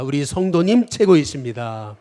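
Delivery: preacher leading a call-and-response; slow call to repeat. Shown as a demonstration. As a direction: declaim it slowly so everyone can repeat it. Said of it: "또 한번 따라해 보겠습니다".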